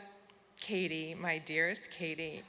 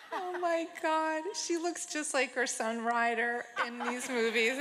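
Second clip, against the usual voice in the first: higher, laughing, a little choked up; laughing through emotion; 6 semitones higher than usual. Real, not acted. Presenter marvelling at old movies of her friend, laughing emotionally. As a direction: talk through teary giggles. Moved while praising friend's films.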